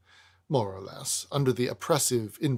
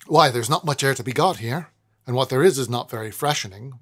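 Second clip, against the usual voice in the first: put-on louder redone lodger accent, quicker at the end; new Weevil accent; 8 dB louder, faster than usual. A narrator's reading. Narrator redoes Weevil's line, in the new accent. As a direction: commit fully to the new character accent. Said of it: "but it is now".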